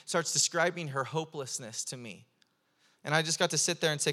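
ordinary delivery

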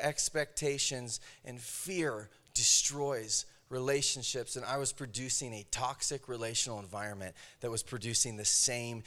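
soft, low, intimate sermon voice; gentle confessional preaching; hushed earnest preaching. Acted. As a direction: draw the congregation in quietly.

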